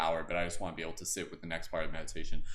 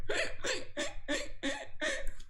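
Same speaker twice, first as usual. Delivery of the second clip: a huge, very high, wild laughing shriek; wild animal laugh; 4 dB quieter than usual. Shown as a demonstration. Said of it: "it's just like a wild animal".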